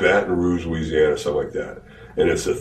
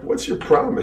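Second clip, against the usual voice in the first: pitched-up what's your problem; high retold challenge; 11 semitones higher than usual. Pushing away his raging partner, high indignant challenge. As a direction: shove him off with a high challenge.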